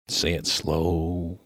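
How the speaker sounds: "say it slow" stretched very slowly; drawn out. Acted. Baffled miner asking the minister to repeat, drawn out slowly. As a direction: draw out each word of "say it slow".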